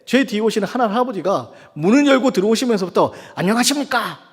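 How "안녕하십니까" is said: mock hearty hello, not truly shouted; imitated loud greeting. Preacher imitating an old man entering a clinic, mock loud greeting. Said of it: "큰 소리로 막 하거더니".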